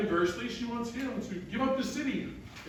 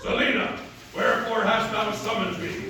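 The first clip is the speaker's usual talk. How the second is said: loud disguised-hero voice, like a crazed beast; strong and scary; 6 dB louder than usual. Acted. Playwright repeating his hero's line to Selina, strong and scary. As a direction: boom it out to sound menacing in disguise. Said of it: "he needs to sound strong and scary".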